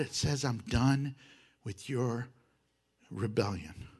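very soft, grave questioning with long pauses; hushed solemnity. Real, not acted. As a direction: let the warning sink in, almost under your breath. Preacher's sober question after the joking.

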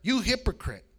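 retold accusation. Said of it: "their response was".

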